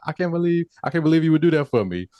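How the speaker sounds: high, fast gushing wife; hammed-up gratitude, rushed and high; mock gushing. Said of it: "she was just like".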